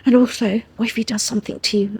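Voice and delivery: soft, breathy-low begging, half under the breath; a hushed plea from frightened servants; hushed pleading. Retold or imitated. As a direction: beg quietly so no one hears. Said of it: "they were begging me".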